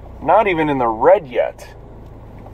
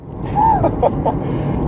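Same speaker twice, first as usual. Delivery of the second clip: high laugh; 3 dB quieter than usual. Real, not acted. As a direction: burst of high giggling. Cracks up at own joke.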